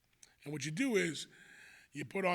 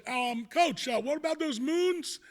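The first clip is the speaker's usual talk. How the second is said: higher, louder mock-innocent question; teasing payback; 7 semitones higher, 4 dB louder than usual. Winning coach asks the beaten Newtown coach, teasing. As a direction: needle the loser, pitch climbing on the question.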